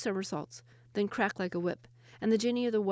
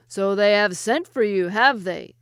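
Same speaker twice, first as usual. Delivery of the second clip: much louder, lion-gruff jinni; 11 dB louder, slower than usual. Acted. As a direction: growl it mockingly like a lion. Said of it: "in a voice as gruff as any lion".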